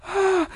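sharp high gasp of horror; high startled gasp; horrified gasp. Retold or imitated. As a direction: gasp out in shock. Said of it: "she got horrified and went".

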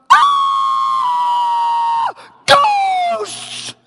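a high shrieked mock cry of ghosts; mock terror. Preacher mocking people who fear ghosts, high shrieked mock terror. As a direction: squeal like a spooked churchgoer. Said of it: "Most of us".

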